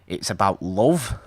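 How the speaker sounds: ordinary talk, nothing marked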